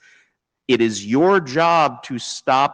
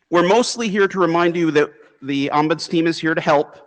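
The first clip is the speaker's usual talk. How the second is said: usual speaking voice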